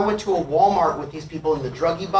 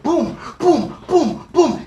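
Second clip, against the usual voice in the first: high, punched booms; imitated banging; 8 semitones higher, much slower than usual. Bee banging its head on can, imitated thuds. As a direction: sound out each thump separately. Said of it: "he starts banging his head against it".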